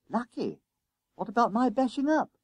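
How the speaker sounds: very high-pitched protest; indignant schoolboy. Acted. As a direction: squeal it in disbelief, without shouting. Boy indignantly disputes being lucky.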